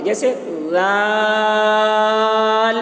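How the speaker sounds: زال stretched very long with a thin sound; long held letter name. Shown as a demonstration. Teacher demonstrates زال for students, stretched and chanted. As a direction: chant زال slowly, tongue tip out. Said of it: "یہ صحیح تلفظ ہے".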